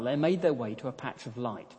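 ordinary talk, nothing marked

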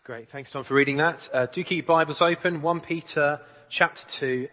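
ordinary talk, nothing marked

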